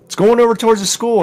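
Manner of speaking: high, loud, quick warning; voicing his son's alarm; urgent kid report. Son warning dad the plane drifts to the school, urgent and high.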